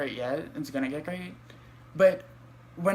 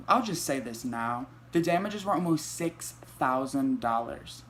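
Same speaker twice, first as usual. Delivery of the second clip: ordinary delivery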